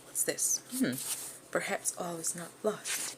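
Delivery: intrigued murmur. Acted. Chance finds the wallet.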